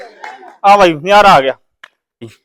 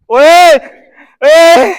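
sharp, loud, higher-pitched cry of alarm; startled shout; 5 semitones higher, 3 dB louder, much slower than usual. Real, not acted. Host to blindfolded player swinging the stick, startled shout. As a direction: yell out to stop him.

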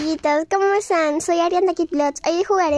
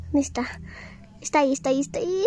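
ordinary delivery

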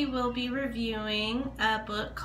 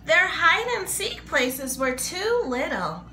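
higher, lively storybook narration; reading to children; 6 semitones higher than usual. Acted. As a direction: read it like a picture book to little kids.

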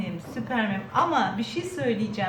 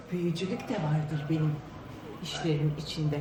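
low, eerie witch voice dropped softer; a low, sinister witch confession; 8 semitones lower, 7 dB quieter than usual. Acted. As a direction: growl it low like a sly witch.

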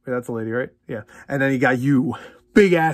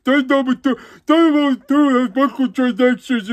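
mock whiny complainer; 8 semitones higher, 4 dB louder than usual. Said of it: "everywhere I go".

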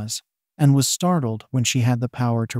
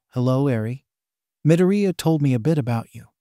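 plain reading voice

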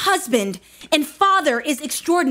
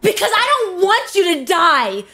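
desperate outburst; 7 semitones higher, 5 dB louder than usual. Acted. Wife's quarrel with husband.

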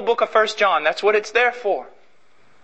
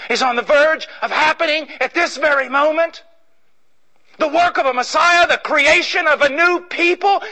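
preaching climax; 9 semitones higher than usual. Acted. Preacher proclaiming the Messiah to the church, shouting high. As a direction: lift the church to its feet.

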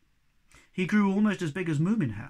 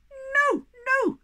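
much higher, louder tiny defiant voice; squeaky little creature; 7 dB louder than usual. Acted. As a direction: pipe up like a tiny brave creature. Tiny creatures refusing firmly.

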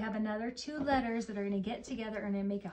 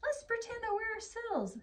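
much higher, slow playful voice; playful rhino; 13 semitones higher, slower than usual. Acted. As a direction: lift the voice for the rhino. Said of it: "says R".

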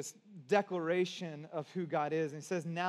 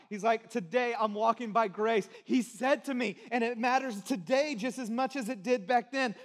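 loud, higher, rolling pulpit delivery; fervent preacher building momentum; full-force preaching; 4 semitones higher, 5 dB louder than usual. Real, not acted. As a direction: hammer the repeated phrases with conviction. Preaches Paul's conviction at full force.

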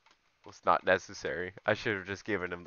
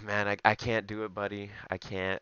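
normal talking voice

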